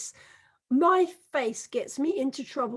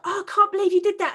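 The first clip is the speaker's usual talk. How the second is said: louder, higher shocked gasp of a line; 6 semitones higher, 5 dB louder, faster than usual. Retold or imitated. Coworker catching her grimace at the baby, shocked. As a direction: blurt it out in disbelief, quickly.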